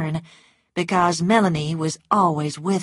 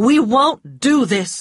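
louder, raised firm refusal; cry; 7 semitones higher, 7 dB louder than usual. A narrator's reading. Ashley pushing Scarlet away, raised firm cry. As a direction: call it out firmly, pulling away. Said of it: "he cried".

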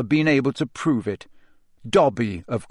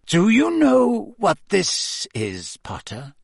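dangerous Snape; 8 semitones higher, slower than usual. A narrator's reading. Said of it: "his eyes glittering dangerously".

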